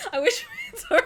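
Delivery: high, loud burst of laughter; laughing. Real, not acted. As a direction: crack up mid-line.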